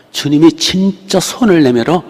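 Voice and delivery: normal talking voice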